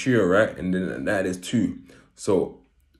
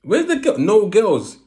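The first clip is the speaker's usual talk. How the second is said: high squeaky surprise; 12 semitones higher, 7 dB louder, slower than usual. Retold or imitated. Squeaky comic peak.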